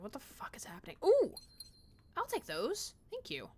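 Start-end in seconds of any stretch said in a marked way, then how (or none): 0.0-1.0 whispered bafflement; hushed retold confusion. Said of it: "I was like". 1.0-1.3 high, louder ooh; pleased surprise; delighted ooh. Real, not acted. Delighted at seeing something.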